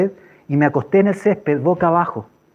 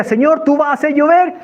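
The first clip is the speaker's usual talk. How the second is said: high, insistent cry to heaven; bold declaration; 9 semitones higher than usual. Retold or imitated. Man declaring rain to the Lord, crying out. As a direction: proclaim it up to God. Loud declaration to God while clapping.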